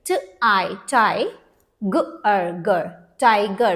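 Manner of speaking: "tie" and "ger" built from sounds, then "tiger"; sounded out. Shown as a demonstration. Teacher demonstrates an open syllable, sounding out "tiger" with long I. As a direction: blend piece by piece with a long I in "tiger". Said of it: "Now let's read this".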